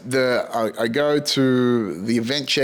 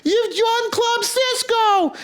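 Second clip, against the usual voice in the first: very high, slow, drawn-out mock fanfare; stretched, sing-song hammed-up congratulations; grand announcement; slower than usual. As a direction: announce it like event staff in a big celebration. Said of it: "And they go".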